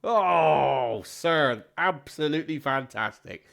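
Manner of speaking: loud, drawn-out "oh", then effusive; lavish praise on the joke; gushing praise. Acted. Comedian congratulating his guest on stage, gushing.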